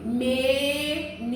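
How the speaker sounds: menino said slowly syllable by syllable, me-ni-no; syllabify menino for children; split into syllables. Shown as a demonstration. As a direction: drag out menino to count its beats. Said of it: "Só na palavra menino são três sílabas".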